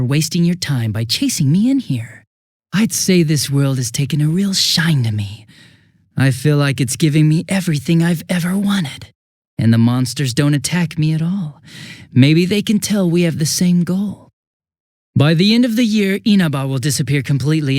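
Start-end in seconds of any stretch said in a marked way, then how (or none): none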